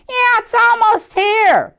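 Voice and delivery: high, bright-eyed friend voice; lightly voiced excited animal friend; excited zoo friends. A narrator's reading. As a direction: cheer it like an eager little animal. Friends excited about Christmas in story.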